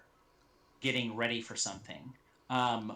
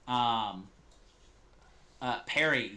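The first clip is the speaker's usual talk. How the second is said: normal talking voice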